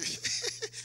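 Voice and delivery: light high-pitched chuckle; high fake laugh. Shown as a demonstration. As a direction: demonstrate a little laugh. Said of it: "let out a few laughter".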